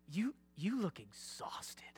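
slow soft whisper; gentle whisper. Retold or imitated. Shepherd to the exhausted sheep, soft whisper. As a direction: breathe it out tenderly. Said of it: "you're like".

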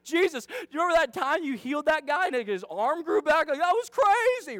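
high, loud, animated storytelling among friends; excited disciple reminiscing. Acted. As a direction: gush it, rising to a high, loud finish. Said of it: "I can just imagine Jesus and his disciples where John's like".

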